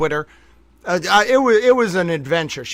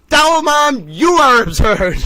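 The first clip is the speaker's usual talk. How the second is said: loud, higher-pitched mock rebuke; mock indignation; 6 semitones higher, 4 dB louder than usual. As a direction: throw the accusation back, playfully outraged. Mock rebuke to absent Towel Mom.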